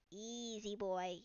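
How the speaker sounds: hushed, slow soothing; calm the spooked beetle gently. Acted. Mad Lad hushing the startled beetle, slow and soft.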